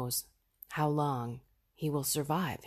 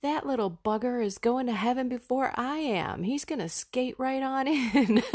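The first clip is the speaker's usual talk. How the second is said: high, animated take on mom's voice; imitating mom's gleeful certainty; 8 semitones higher, 4 dB louder than usual. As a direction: quote mom, pitch up and building louder into a laugh. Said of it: "she says it like this".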